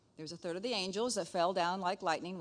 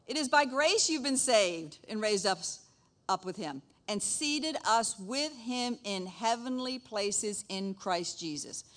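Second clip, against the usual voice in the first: slow, deliberate scripture read; measured reading from the Bible; 3 semitones higher, 4 dB louder, slower than usual. Real, not acted. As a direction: read the verse with weight.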